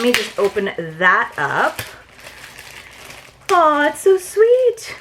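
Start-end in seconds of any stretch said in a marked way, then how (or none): 3.5-4.9 delighted coo. Real, not acted. Unboxing a sweet pouch, cooing high and slow. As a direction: gush over it like a tiny puppy.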